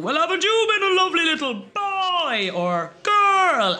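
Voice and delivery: very high, sing-song jolly Santa. Retold or imitated. Santa calling up to the lads on the roof in a jolly voice. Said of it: "the Santa Claus was going".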